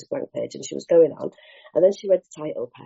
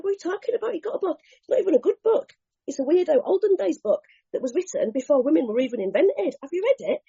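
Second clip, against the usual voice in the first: high, mocking disbelief; scornful disbelief; 9 semitones higher than usual. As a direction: sneer at the old book. Mocking the book.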